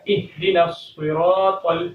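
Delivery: الصراط with a heavy emphatic saad; الصراط with a full saad; correct saad. Shown as a demonstration. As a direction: say الصراط with a thick, dark s. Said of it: "صاد پڑھنا ہے".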